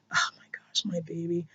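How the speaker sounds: whispered, choked up with real emotion; emotional whisper. Real, not acted. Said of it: "I'm just getting really emotional".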